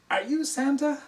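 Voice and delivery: high and loud, wide-eyed question; little child's voice. Retold or imitated. Four-year-old looking up at Santa, high eager question.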